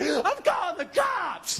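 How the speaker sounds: high, drawn-out panicky threat; acted outraged shout. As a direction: cry it out slowly, hammed-up. Acts himself threatening wife.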